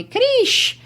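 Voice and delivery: "Krish" said with a final sh sound; Rio pronunciation. Retold or imitated. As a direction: say "Krish" the way Rio people do, sh instead of s. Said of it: "instead of calling me [name]".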